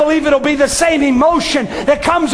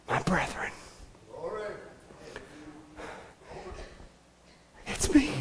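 hushed, much softer Jesus voice; Jesus softly revealing himself; tender hush; 10 semitones lower, 19 dB quieter than usual. Acted. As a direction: speak it gently, barely above a breath.

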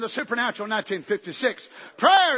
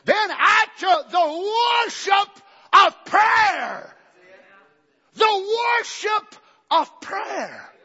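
loud, higher, slow pulpit cry; preaching; 7 semitones higher, 5 dB louder, much slower than usual. Acted. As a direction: stretch each word out to the church. Preaching at full force.

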